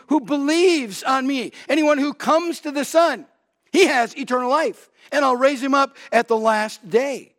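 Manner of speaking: rising pulpit fervor; preaching at full force. Acted. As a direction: hammer the parallel home. Paraphrases both verses intensely.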